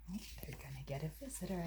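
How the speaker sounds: fast hushed whisper to the listeners; whispered aside. Real, not acted. Reader whispering an aside to the children. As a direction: lean in and whisper the secret.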